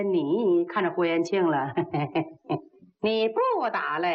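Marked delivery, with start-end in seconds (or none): none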